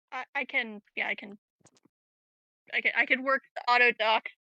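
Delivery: ordinary talk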